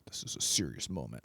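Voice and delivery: mock solemn voice. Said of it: "and it's like".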